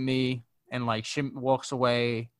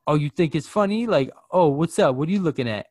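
higher, louder mock challenge; confrontational; 5 semitones higher, 4 dB louder than usual. Mad customer challenging a bystander, mock confrontational. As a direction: imitate someone picking a fight. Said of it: "she gives me that look like".

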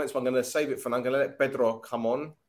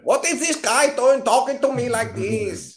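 high, slow put-on Chinese accent; mock Chinese accent, squeaky high; Chinese accent impression; 12 semitones higher, 7 dB louder, slower than usual. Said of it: "that's the best impression I can do".

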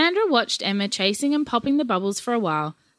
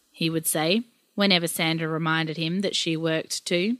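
usual speaking voice

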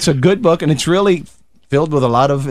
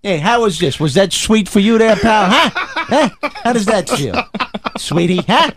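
a hammed-up Brooklyn threat, higher and laughing; mock New York tough; 6 semitones higher, slower than usual. Brooklyn native playing a New Yorker menacing a sweet tourist. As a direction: needle the tourist like a wiseguy.

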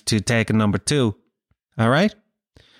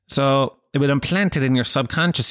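normal talking voice

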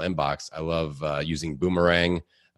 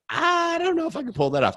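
squeaky doubtful reply. Retold or imitated. Retold hesitant reply to accent request.

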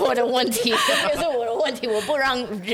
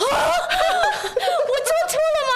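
much higher, laughing feigned innocence; mock innocent surprise. 回应老师责问时，笑着装无辜的惊讶. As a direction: play dumb and wide-eyed with a giggle. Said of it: "我就说".

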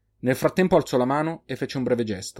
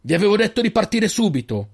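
higher, louder angry shout; 9 semitones higher, 4 dB louder than usual. A narrator's reading. The old man shouts at the boys for not leaving. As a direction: yell at them for still being here. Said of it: "urlò il vecchio".

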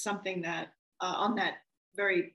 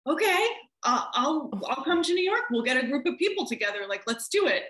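higher, louder quoted reply; eager agreement; 6 semitones higher, 5 dB louder, faster than usual. Retold or imitated. Director agreeing to bring people to New York, eagerly. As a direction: act out scrambling to say yes.

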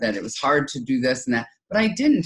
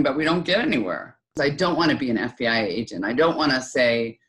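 ordinary talk, nothing marked

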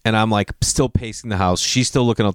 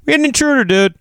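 mock bro voice; 13 semitones higher, 4 dB louder, faster than usual. Imitating someone bragging about intruder.

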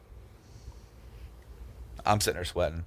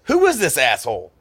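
a mock outraged dad, higher and louder; angry dad; 6 semitones higher, 8 dB louder than usual. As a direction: bark it like an irritated father. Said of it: "He goes".

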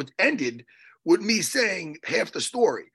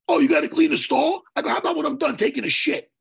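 loud, high mocking yell; sarcastic yell from the stall; sarcastic shout; 10 semitones higher, 5 dB louder than usual. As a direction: throw his words back at him loudly.

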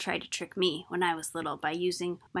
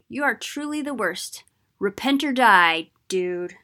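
much louder, fed-up telling-off; exasperated scolding; 3 semitones higher, 5 dB louder than usual. Acted. Alma scolding the wicked townspeople, loud and exasperated. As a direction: scold them like a worn-out parent.

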